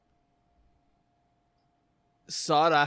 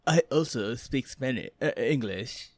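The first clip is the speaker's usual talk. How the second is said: mock French accent, hesitant; bad French accent. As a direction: put on a clumsy French accent. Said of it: "that's a horrible French accent".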